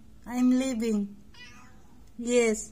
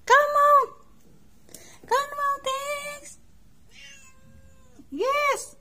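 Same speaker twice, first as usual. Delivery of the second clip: high, loud call; loud high coaxing call; calling the cat; 10 semitones higher, 3 dB louder than usual. Real, not acted. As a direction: sing it out high to lure the cat.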